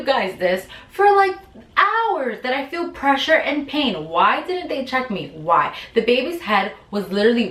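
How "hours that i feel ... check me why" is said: ordinary delivery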